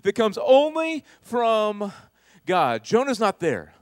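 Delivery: loud, high, impassioned sermon delivery; full-force preaching. Real, not acted. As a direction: preach it out to the whole room.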